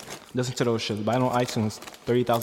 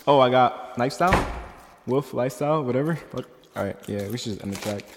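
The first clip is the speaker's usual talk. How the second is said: ordinary talk, nothing marked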